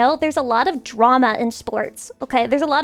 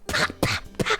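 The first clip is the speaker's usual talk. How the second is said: mock spitting noise; 8 dB quieter than usual. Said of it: "Me spitting rapid fire at you".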